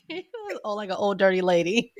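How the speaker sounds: high voice cracking with laughter; crying laughing through the words. Real, not acted. Cracking up over the [name] meme, laughing hard. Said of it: "laugh cry".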